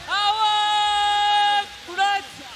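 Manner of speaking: drawn-out rally shout. Acted. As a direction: stretch each word into a huge slow shout. Announcer's rallying call to crowd. (लांब ओढलेली जोरदार घोषणा)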